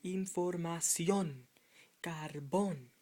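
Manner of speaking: "Información, carbón" slowly, punching SION and BON; slow demonstration of "Información, carbón" with the endings stressed; stressed final syllables. Shown as a demonstration. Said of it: "You see it?".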